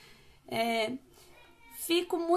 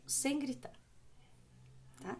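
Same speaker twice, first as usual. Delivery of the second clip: usual speaking voice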